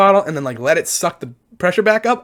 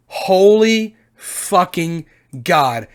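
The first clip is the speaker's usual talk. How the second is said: drawn out, slow and strained; slow pained groan; much slower than usual. Real, not acted. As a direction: drag it out in agony. Reacting to nut shot pain.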